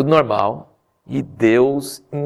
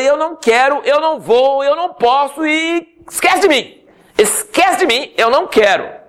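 high, pleading refusal, much higher voice; whiny Moses; 13 semitones higher than usual. Acted. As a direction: whine like a sulking man who won't go. Moses whines, refusing God.

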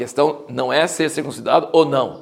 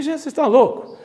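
high-pitched mock disbelief; incredulous; 11 semitones higher, faster than usual. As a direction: retell it as a high, incredulous question. Retelling his reaction to the company.

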